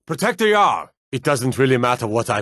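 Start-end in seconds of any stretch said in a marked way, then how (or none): 0.1-0.9 alarmed shout. Acted. Guard raising the alarm to protect the Jarl, shouting. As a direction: yell it out to rally the guards.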